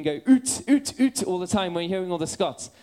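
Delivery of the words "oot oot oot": loud mock-Scottish "oot"; joking Scottish accent. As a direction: copy the Scots for a laugh. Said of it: "would start joking".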